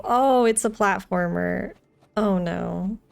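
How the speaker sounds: normal talking voice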